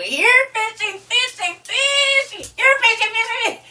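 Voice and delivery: high, loud sing-song calling; loud fish call. Pretend. Host pretending to fish for viewers, loud high calling. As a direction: holler for the fish to bite.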